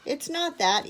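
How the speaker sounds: high, mildly tearful protest; understated child's sob; sobbing, acted. A narrator's reading. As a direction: protest through pretend tears. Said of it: "Edith sobbed".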